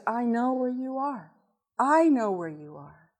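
soft, reassuring, unhurried; very calm voice. Retold or imitated. The Lord reassures a lost wife, very calm voice. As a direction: settle someone upset with a quiet, steady reassurance. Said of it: "Very calm voice".

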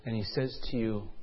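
ordinary delivery, nothing marked

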